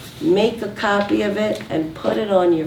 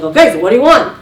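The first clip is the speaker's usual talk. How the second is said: loud, brushed-off question; acted husband losing patience; impatient husband; 7 dB louder, much faster than usual. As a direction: cut the caller off impatiently. Impatient husband snaps back.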